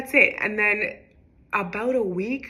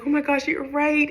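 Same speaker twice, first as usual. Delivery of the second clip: imitating a delighted mom, much higher; high, thrilled retold exclamation; happy realization; 9 semitones higher, 4 dB louder than usual. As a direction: act out happy, amazed relief. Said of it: "And she's so happy".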